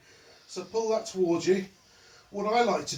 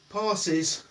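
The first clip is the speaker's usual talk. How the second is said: ordinary delivery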